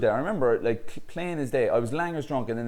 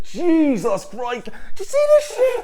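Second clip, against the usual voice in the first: 1 laughing outcry; 7 dB louder than usual. Retold or imitated. Showing the boys his nose.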